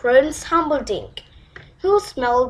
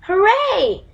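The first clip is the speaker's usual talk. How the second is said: high, louder cheer of delight; joyful shout; 8 semitones higher, 4 dB louder than usual. Acted. Poppy cheering the frog who found her ball, high shout. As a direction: cheer as the ball comes back. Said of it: "shouted Poppy".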